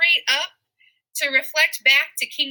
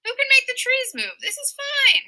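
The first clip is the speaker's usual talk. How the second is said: much higher, put-on smug Macbeth voice; a squeaky, over-confident king brushing it off; cocky Macbeth; 12 semitones higher than usual. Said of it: "And he's like".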